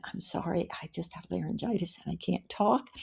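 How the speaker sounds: a soft, unvoiced laryngitis whisper. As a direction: whisper as if voice is gone. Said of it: "so I had to whisper".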